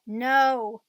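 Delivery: put-on a loud, sobbing protest from a boy; crying no. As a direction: burst out with a loud, tearful no.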